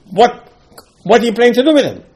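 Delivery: high, loud, fast alarmed question; alarmed Moshe. Acted. Moshe asking Hashem what He plans, high and alarmed. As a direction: sound startled and pleading.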